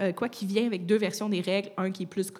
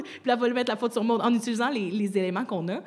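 usual speaking voice

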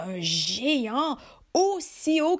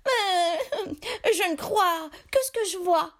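higher, sly fisherman voice; 8 semitones higher than usual. Acted. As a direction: insist like a cunning fisherman. Fisherman insists.

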